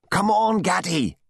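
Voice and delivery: high-pitched shouted call; urgent shout. A narrator's reading. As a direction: shout it out to spur someone on. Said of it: "shouted Dutton".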